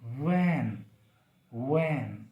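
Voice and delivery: वैन with a buzzing V, lips spread; lip-teeth V. Shown as a demonstration. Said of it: "हमारे lips spread होंगे".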